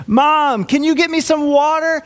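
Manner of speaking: put-on high, needy child's plea; whiny kid. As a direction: beg like a kid ignoring dad beside him. Kids asking mom, ignoring dad.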